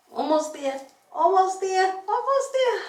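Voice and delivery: rising strained effort; pushing through burning arms; straining arms. Real, not acted. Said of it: "Oh, my arms are burning".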